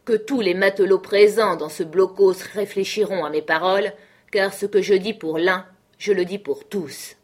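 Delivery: Silver raising his voice for the whole crew; speaking up so every man hears. A narrator's reading. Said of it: "il éleva la voix".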